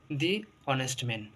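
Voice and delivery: দি অনেস্ট man with দি as "dee"; "dee" because the h is silent. Shown as a demonstration. Teacher showing the silent h case, দি as "dee". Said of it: "দা না বলে দি বলবো".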